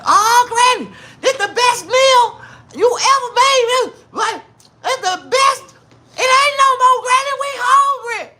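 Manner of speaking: put-on high, loud childish begging; hungry kids. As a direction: squeal and plead like starving kids. Kids praise then beg.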